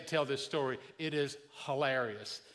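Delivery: ordinary talk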